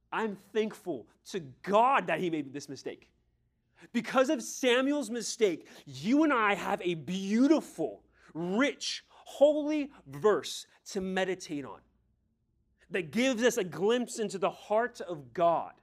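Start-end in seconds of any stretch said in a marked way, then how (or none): 3.9-15.7 raised, louder preacher's voice; forceful preaching. Real, not acted. Preacher telling the congregation what Samuel's mistake gave, forceful preaching. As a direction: preach it out with high, loud emphasis.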